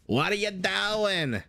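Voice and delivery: put-on high, drawn-out yelled Boston accent; mock Boston street-argument voice, slow and high. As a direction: imitate an angry Bostonian in a hammed-up accent. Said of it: "that Boston accent".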